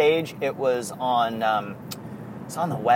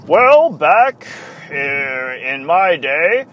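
put-on high, loud, slow pompous old announcer voice; John Sterling impression; 5 semitones higher, 7 dB louder, slower than usual. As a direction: ham up a self-important old radio man, dragging the words. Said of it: "She'll try and do his voice".

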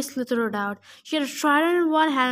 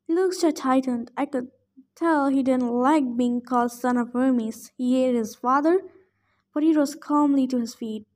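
usual speaking voice

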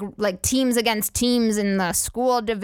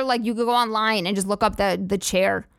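usual speaking voice

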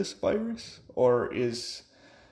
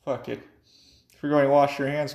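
ordinary talk

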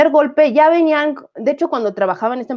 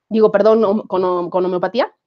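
normal talking voice

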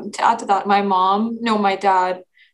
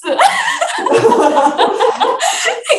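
long, high, loud burst of laughter; helpless high-pitched giggling fit; laughing hard; 5 dB louder than usual. Real, not acted. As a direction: crack up at a joke. Group cracks up at dress joke.

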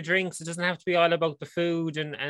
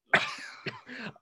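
choking cough; 8 semitones higher, 5 dB quieter than usual. Real, not acted. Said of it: "it went down the wrong way".

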